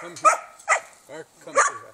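high, loud call across the yard; loud call for Ark to come; calling a dog. Real, not acted. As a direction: summon the dog from afar.

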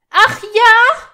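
high shout at full force; furious challenge. A narrator's reading. Hermine challenging Malfoy's calm yes, furious shout. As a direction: escalate into a yelled, incredulous question.

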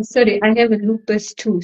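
usual speaking voice

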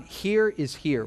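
slow, deliberate. Real, not acted. Preacher giving his flock a motto, slow and deliberate. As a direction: lay each word down slowly. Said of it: "three-word thing to think on".

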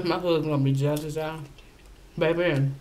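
eating between words; chewing while talking. Real, not acted. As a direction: mouth partly full of food. Seen 0: Wing held at mouth, jaw working during first words, then dipping.

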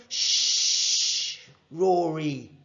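breathy shush, then the name; hushing. A narrator's reading. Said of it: "said Mohammed".

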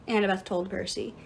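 usual speaking voice

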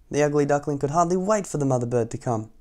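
ordinary delivery, nothing marked

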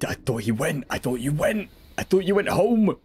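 from puzzled to shocked shout; rising disbelief. Real, not acted. Enemy surprises him.

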